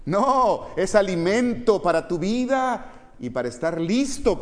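loud, high sermon delivery; high, ringing plea from the pulpit; impassioned preaching. Acted. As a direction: preach it out forcefully to the congregation. Preaching at full force.

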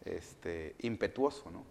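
normal talking voice

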